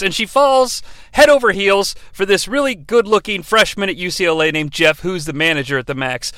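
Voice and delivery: ordinary delivery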